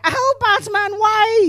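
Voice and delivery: very high whining oh Batman why; whiny victim. Pretend. Blocker pleading with Batman, high squeaky whine. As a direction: squeal it like a wronged crowd blocker. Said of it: "when they're like".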